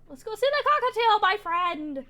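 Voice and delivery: high, loud and bubbly; excited. Acted. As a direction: call out the line with eager delight. Player reading a cheerful character.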